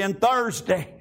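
ordinary talk

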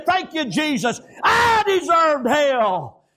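ordinary talk, nothing marked

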